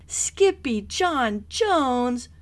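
slow, high, loud shout; loud cry. A narrator's reading. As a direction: call out his name in shock. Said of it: "everyone cried".

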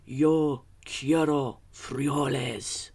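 somber declaration. A narrator's reading. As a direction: declare it gravely, slowly. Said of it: "in his most somber voice declared".

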